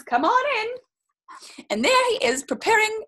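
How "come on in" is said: put-on slow, higher, welcoming old man; welcoming invite. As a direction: invite the cat in kindly. So he just says.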